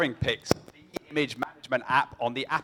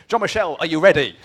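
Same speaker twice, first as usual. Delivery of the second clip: calling out; 4 semitones higher, 9 dB louder than usual. Real, not acted. Host calls out to presenter.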